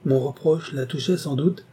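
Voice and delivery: plain reading voice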